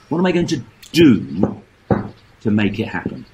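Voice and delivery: usual speaking voice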